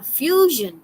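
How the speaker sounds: "fusion" drawn out slowly as "FYOO-zhun"; pronunciation demo. Shown as a demonstration. Teacher modelling the first colour word for the class, pronunciation demo. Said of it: "how do you pronounce the first one".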